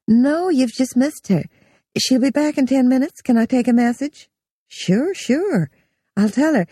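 sunny secretary voice on the telephone; chatty office receptionist. A narrator's reading.

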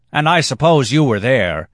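high, loud jeer; challenging cry. A narrator's reading. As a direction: cry it out as a taunt.

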